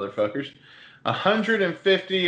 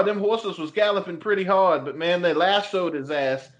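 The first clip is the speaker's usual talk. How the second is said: a higher, hammed-up Boston storyteller; Boston accent; 4 semitones higher than usual. Telling friends the Mountie chase, lively Boston accent. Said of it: "once you get into that Boston accent".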